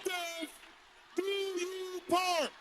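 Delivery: slow, soft-edged high wail of a refrain; theatrical refrain. Real, not acted. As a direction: stretch each word out high and sustained. Theatrical refrain interrupting the talk.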